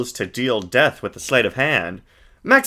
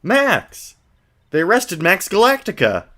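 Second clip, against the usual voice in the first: put-on higher, brighter girl voice; Maya voice; 8 semitones higher than usual. Streamer voicing Maya to the viewers, girlish and high. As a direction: play an eager young girl.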